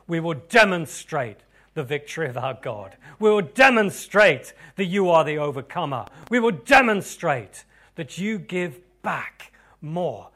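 loud, building repeated proclamation; fervent declaration. Acted. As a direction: drive home each demonstrate. Declaring prayer crescendo.

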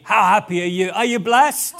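ordinary talk, nothing marked

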